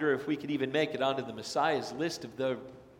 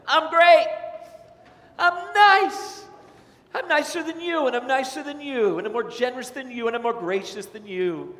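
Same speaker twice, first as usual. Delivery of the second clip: much higher, loud, smug bragging; self-righteous boaster; 8 semitones higher, 7 dB louder than usual. Retold or imitated. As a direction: crow about how nice you are. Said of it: "raised their hand and said".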